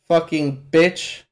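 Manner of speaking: ordinary talk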